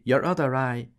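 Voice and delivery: two barmaids giggling in unison. A narrator's reading. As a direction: giggle it together. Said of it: "In a giggling peal".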